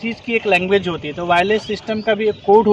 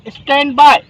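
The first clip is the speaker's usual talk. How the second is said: crisp raised stand by; radio code demo; 4 semitones higher, 6 dB louder than usual. Shown as a demonstration. Trainer showing what to say when busy, crisp radio code. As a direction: say it like a busy operator on air. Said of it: "मुझे आपको message देना है".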